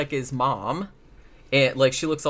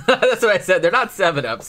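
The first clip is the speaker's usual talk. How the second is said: talking through real laughter, higher and louder; laughing; 5 semitones higher, 6 dB louder than usual. Real, not acted. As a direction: protest while cracking up. Laughing at the soda joke.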